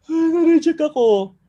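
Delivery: mock self-pity; mock sulking. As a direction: whine it like someone wallowing over a no. Mocking a dejected rejected networker.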